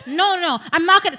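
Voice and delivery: high, loud retold protest; alarmed refusal. Woman refusing her naked date, alarmed protest. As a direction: act out a panicked refusal.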